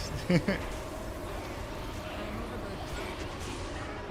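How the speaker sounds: breathy laugh. Real, not acted. Laughing at trust fund joke.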